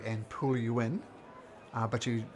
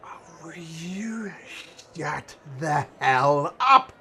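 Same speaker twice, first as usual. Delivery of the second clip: hissed start, then a slow loud yell; snap, then bellow it out; angry shout; 6 semitones higher, 12 dB louder, slower than usual. Acted. Barkeep snaps at whiny character.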